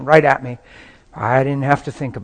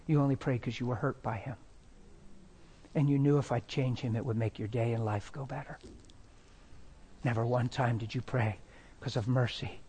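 soft, hushed, low-key tenderness; gentle, humble correction; humble, gentle voice of God; 3 semitones lower, 16 dB quieter than usual. Retold or imitated. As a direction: speak very softly, making peace with her. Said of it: "He's so humble".

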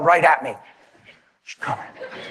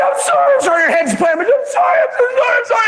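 much higher, wailing mock sob; sobbing apology; faster than usual. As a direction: cry it out like a broken wife. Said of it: "She's clutching me".

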